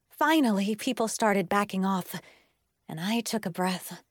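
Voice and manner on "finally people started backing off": usual speaking voice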